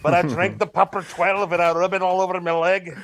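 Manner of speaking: put-on broad comic Irish accent; Conor McGregor. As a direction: play a boastful fighter in stage Irish. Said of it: "It's the best one".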